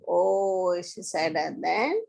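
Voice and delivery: ordinary delivery, nothing marked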